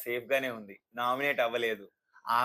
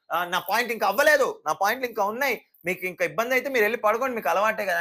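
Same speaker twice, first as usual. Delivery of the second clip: higher, louder, fast defiant talking-back; irritated shrill retort; 6 semitones higher, 5 dB louder, faster than usual. Retold or imitated. Sreeja defying captain Sanjana, higher, louder and fast. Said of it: "ఆ కీచు గొంతు వేసుకొని".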